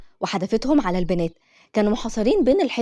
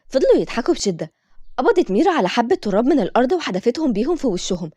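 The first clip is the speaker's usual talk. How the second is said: ordinary delivery, nothing marked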